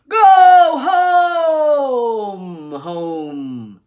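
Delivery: high, loud shriek fading into a wail; shrieking wind. Acted. The wind shrieks at Irene, then falls to a wail. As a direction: shriek it like a gale. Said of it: "it shrieked".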